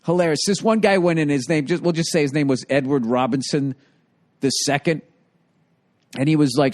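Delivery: ordinary delivery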